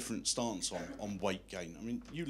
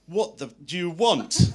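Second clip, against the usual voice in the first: loud, high, slowed-down angry demand; exasperated shout; 7 semitones higher, 13 dB louder, slower than usual. Retold or imitated. Stewardess turns round on the man at the bar, exasperated shout. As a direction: act out her losing patience, much louder and drawn out. Stewardess snaps after failed guesses.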